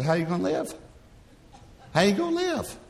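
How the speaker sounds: high, disbelieving "how you gonna live"; incredulous pastor. Retold or imitated. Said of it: "Like that".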